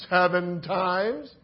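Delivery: slow rising query; slow questioning repeat. Shown as a demonstration. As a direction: draw out seven times as a doubtful question. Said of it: "repeats Peter's answer questioningly".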